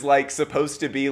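ordinary talk, nothing marked

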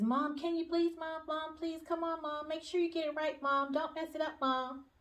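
put-on high, eager little-girl voice begging Mom; a six-year-old nagging her mom for the photo; pleading child.

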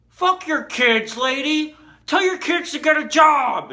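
high, loud, drawn-out retort; acted shouting back. Telling off the lady neighbor, acted angry shout. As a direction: yell it back, hammed-up and indignant.

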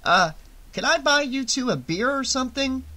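high-pitched, anxious offer; nervous, placating question in a high voice; frightened. A narrator's reading. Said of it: "Shelley was frightened now".

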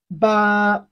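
"Ba" held long on a level pitch; flat tone. Shown as a demonstration. Teacher models Chinese eight for learners, flat-tone "Ba". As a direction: sustain a firm, unbending "Ba". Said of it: "the flat tone of Chinese".

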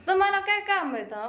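high, loud challenge; challenging question. Real, not acted. Wife challenges husband about his busy day, high and loud. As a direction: demand what's so important.

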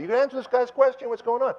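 high, loud and hurried demand; demanding onlooker. Retold or imitated. Voices onlookers demanding an answer.

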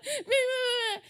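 high, loud mocking babble; teasing brother. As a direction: imitate a bratty tease. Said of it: "bullying or teasing me".

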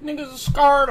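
high-pitched, exaggerated DC accent; mock DC accent. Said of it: "like how we just said".

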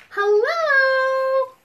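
big, high, drawn-out hello; громкое приветствие. Pretend. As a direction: call out hello far too loudly. Group hello, said too loudly.

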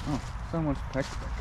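ordinary delivery

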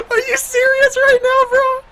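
high laughing shout. Real, not acted. Shouting at game.

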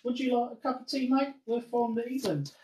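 mock British accent. Joking about Brits offering tea, mock British accent. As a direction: lightly put on a British accent. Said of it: "like, we're British?".

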